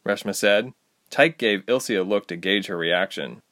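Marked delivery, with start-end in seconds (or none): none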